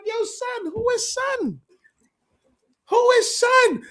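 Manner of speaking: high, excited, rising to a shout; shocked disbelief. Retold or imitated. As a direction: yell it like you can't believe who just showed up. Retold shock at seeing a guest.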